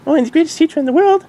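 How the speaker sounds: very high, loud, fast gushing; excited exclaim. Acted. Dinky bragging about her teacher to her dad. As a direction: burst out proudly. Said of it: "exclaimed Dinky".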